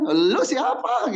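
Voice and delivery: a car dealer rebuffing a pushy buyer, mock curt; rude dealer retort. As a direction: snap back like an annoyed dealer. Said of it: "gitu ya".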